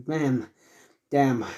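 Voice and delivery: ordinary delivery, nothing marked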